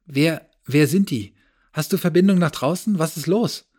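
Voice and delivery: panicked calls to the kitchen; alarmed calling out. A narrator's reading. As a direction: call out in alarm, grabbed from bed. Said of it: "rief nach Fabien".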